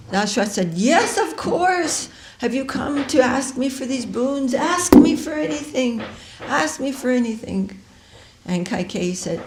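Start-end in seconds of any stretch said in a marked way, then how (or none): 0.7-7.7 eager king. Acted. King eagerly grants wife's boons.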